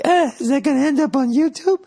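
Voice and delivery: very high mock-anxious whine; self-conscious worry. Comedian fearing he was filmed, mock self-conscious high voice. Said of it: "I felt all self-conscious".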